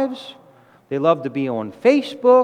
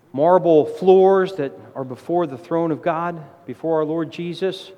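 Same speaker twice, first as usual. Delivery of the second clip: normal talking voice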